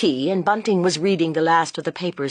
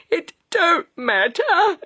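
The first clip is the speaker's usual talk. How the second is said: higher, crying through the words; tearful cry; 13 semitones higher, 3 dB louder, slower than usual. A narrator's reading. Mrs Bunting reassuring Joe through hysterical tears. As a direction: fight back tears, brushing it off. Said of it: "tears streaming, sobbed and laughed".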